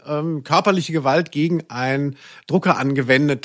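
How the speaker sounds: ordinary delivery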